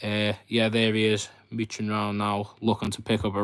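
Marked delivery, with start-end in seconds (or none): none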